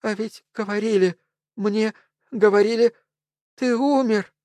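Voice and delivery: high, trembling disbelief; as if seeing a ghost; tearful woman. A narrator's reading. Woman tearfully greets supposed dead Elvis.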